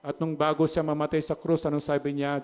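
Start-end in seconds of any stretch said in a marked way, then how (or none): none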